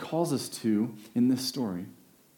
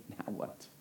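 whispered now what; 17 dB quieter than usual. Retold or imitated. Voicing people's question to God.

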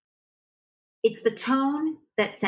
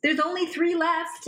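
higher and slow, hard-sell urgency; pushy marketer hype; 9 semitones higher, slower than usual. Retold or imitated. Internet marketer selling to customers, urgent hype voice. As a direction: push the buyer like an internet ad. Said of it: "the internet marketers".

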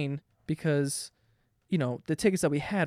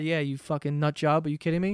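normal talking voice

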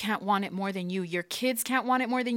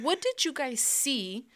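usual speaking voice

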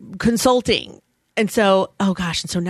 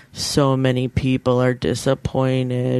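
low, slow, drawn-out moan; mock guilty lament; 5 semitones lower, slower than usual. Mimicking own morning guilt over clients, mock guilty lament. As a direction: wallow in it, half-joking, dragging the words. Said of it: "every morning I wake up going".

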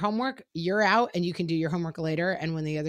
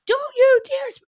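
much higher, loud cut-off yell; losing temper; 10 dB louder than usual. Retold or imitated. Mom losing her temper at her son, sharp yell. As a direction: snap at him like you've lost it. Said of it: "I lose my temper".

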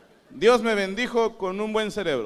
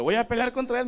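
offended; 7 semitones higher than usual. Retold or imitated. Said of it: "me ofendí".

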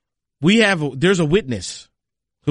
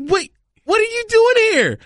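much higher, shocked disbelief; surprised. Retold or imitated. Asking Daniel why he's at the media center, shocked. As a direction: sound floored to see him.

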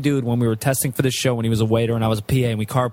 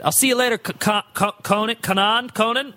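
high stammering goodbye; 8 semitones higher than usual. Retold or imitated. Rick stammering goodbye to Conan.

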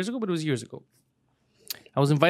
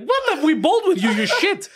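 high, laughing mock friend voice; friends' high-pitched laughing mockery; 12 semitones higher, 3 dB louder than usual. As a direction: squeal it like a teasing buddy. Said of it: "especially my friends, like".

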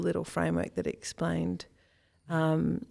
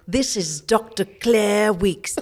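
put-on slow, plummy old-fashioned Australian; posh Australian accent; 3 semitones higher, 5 dB louder, slower than usual. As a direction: speak as a cultivated Australian lady. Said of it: "she was a proper cultivated Australian".

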